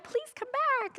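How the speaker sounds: high mock begging; pleading. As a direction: plead with them to stay. Begging followers to return.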